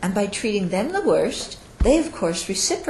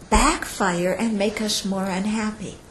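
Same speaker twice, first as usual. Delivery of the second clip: usual speaking voice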